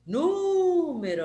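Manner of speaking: NÚ in "Número" long and high; stress on NÚ. Shown as a demonstration. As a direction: slowly call out "Número", holding the first syllable. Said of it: "antepenúltima sílaba forte".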